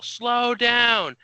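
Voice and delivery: high, loud shout from the roadside; retold yell. Strangers on the road yelling at the driver, loud. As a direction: bark it at a passing car. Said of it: "turn around and yell".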